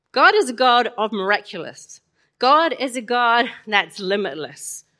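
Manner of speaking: ordinary talk, nothing marked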